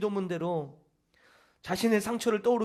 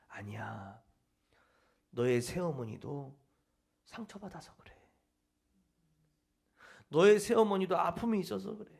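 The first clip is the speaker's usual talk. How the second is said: gentle voice of God; 3 semitones lower than usual. Retold or imitated. God answers the wounded young man, gently and tenderly. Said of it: "들리는 음성이 이런 음성이었대요".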